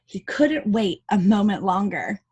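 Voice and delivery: ordinary delivery, nothing marked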